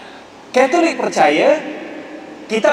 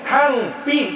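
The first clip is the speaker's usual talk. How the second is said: higher Kedah Hampi with a falling end; Kedah dialect; 5 semitones higher than usual. Shown as a demonstration. Lecturer pointing out the Kedah word, Kedah accent. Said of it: "tak ada tu".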